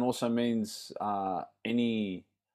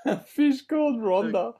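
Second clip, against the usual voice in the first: high, giggly repeat; laughing; 11 semitones higher than usual. Real, not acted. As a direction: laugh it out, much higher.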